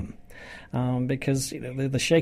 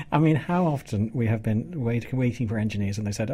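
normal talking voice

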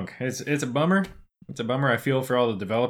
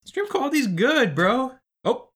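high silly voice; 14 semitones higher than usual. Acted. Joking about stream.

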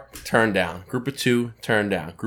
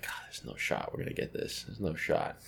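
mock despair; 6 semitones lower, 18 dB quieter than usual. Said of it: "he's like".

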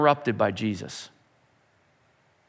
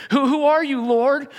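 high, louder, shaken asking; awed question; 10 semitones higher, 4 dB louder than usual. Acted. Saul on the ground asking the heavenly voice, high and awed. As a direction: ask it in awe and fear.

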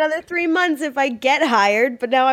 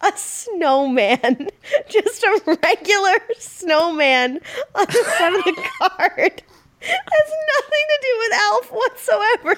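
slow, helpless tearful laughter; crying laughing; 6 semitones higher than usual. Real, not acted. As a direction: laugh till you can barely talk. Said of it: "we've broken [name] forever".

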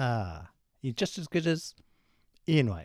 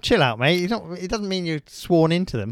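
loud exasperated outburst; loud mock outrage at a director; exasperated rant; 3 semitones higher, 8 dB louder than usual. Real, not acted. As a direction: blast it like a fed-up mate. Outraged at Spielberg's rule.

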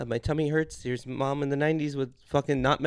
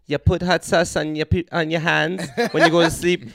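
mock psychic advice; 2 semitones higher, 8 dB louder than usual. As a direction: ham up the psychic's sure advice. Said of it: "Call me now".

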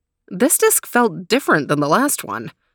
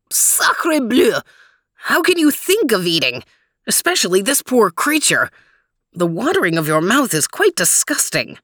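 put-on indignant French-accented cry; 2 semitones higher than usual. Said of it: "cried Max".

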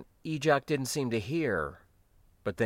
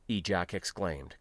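ordinary delivery, nothing marked

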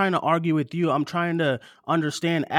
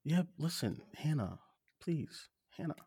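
very soft, lowered appeal; quiet pleading; 14 dB quieter than usual. Real, not acted. Host pleads with his upset co-host, quietly. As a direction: beg gently for a chance to speak.

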